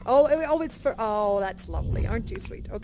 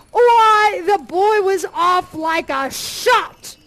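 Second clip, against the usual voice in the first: excited narration; 5 semitones higher, 9 dB louder, slower than usual. A narrator's reading.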